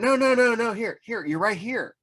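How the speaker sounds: urgent, excited correction; hurried protest. Retold or imitated. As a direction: jump in fast to correct him. Correcting the artist's mistake.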